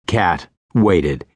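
ordinary delivery, nothing marked

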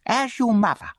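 high, needling bully's voice; taunting charity boy. A narrator's reading. Charity boy aggravates the orphan at dinner, high taunting.

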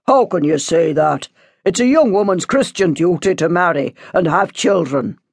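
lightly shocked priest's rebuke; disapproving priest. A narrator's reading. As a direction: remind them of their duty.

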